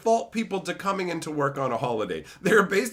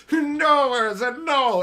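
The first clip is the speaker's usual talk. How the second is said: high, loud mock plea; Karn pleading; 10 semitones higher, 7 dB louder, slower than usual. Karn begging Urza to stop, high hammed-up plea. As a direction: squeal it like a scared victim. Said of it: "Karn's all like".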